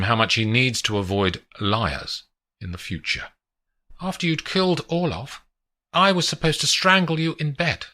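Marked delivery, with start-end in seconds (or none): 4.0-7.9 lightly voiced young woman, higher; a hint of a higher female voice; woman showing off the view; Adelina's voice.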